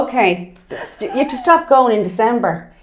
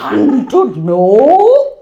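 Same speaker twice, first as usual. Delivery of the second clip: long, drawn-out Welsh-style rise; attempted Welsh accent; 3 semitones higher, 6 dB louder, much slower than usual. Mock listener emailing in, attempted Welsh accent. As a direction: stretch it slowly in a badly faked Welsh accent.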